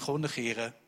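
ordinary talk

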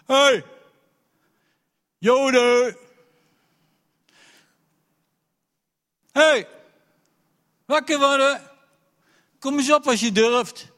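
bellowing high and loud across the valley; a giant shouting insults from the valley floor; giant's taunt. Acted. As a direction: roar a challenge at the enemy camp. Giant Goliath taunting Israel.

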